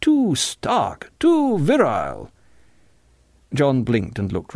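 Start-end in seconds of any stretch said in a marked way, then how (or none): 0.0-2.3 high, drawn-out praise; high-pitched affected admiration; pretentious Clevers. A narrator's reading. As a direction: draw it out high like an art snob.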